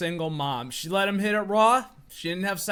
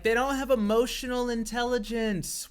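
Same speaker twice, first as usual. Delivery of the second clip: higher, mocking imitation; mocking buzzword quote; 5 semitones higher than usual. Man quoting the buzzword women like, mocking. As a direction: parrot their complaint in a higher voice. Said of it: "There's the buzzword they all like".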